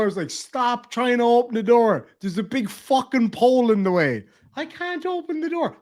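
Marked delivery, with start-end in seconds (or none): 0.3-4.2 louder, raised-pitch retold telling-off; mock exasperated yell, hitting the swearing. Retold exasperated argument with driver. 4.5-5.8 high-pitched imitation of a helpless old driver; mimicked high-voiced complaint; mock old lady.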